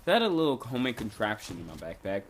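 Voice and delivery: usual speaking voice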